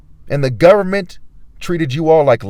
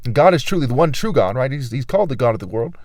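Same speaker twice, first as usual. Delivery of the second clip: ordinary delivery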